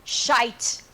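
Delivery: put-on the word shite in a light Irish accent, said as "shite".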